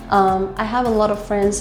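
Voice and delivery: ordinary talk, nothing marked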